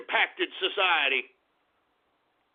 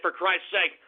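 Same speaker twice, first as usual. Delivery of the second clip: ordinary talk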